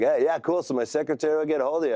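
breezy caller. Retold or imitated. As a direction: brush it off like a busy exec. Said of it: "He's like".

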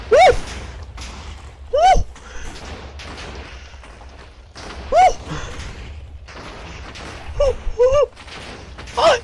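quick high "woo" yelps; short high whoops. Real, not acted. Player reacting to the game, short high whoops. Startled yelps during risky play.

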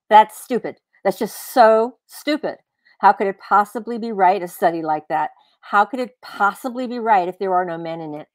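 loud, scornful scoff at a silly idea; scoffing outburst. Acted. Arguing man scoffs loudly at woman's idea. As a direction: blurt it out, disgusted and dismissive.

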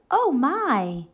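ordinary delivery

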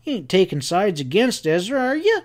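high-pitched accusing questions; fierce. A narrator's reading. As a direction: challenge his loyalty, pitch climbing at the end. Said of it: "he went on fiercely".

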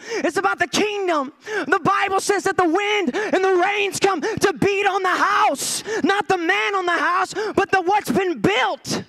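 high, shouted revival preaching; full-force preaching. Real, not acted. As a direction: drive it home like a fired-up preacher.

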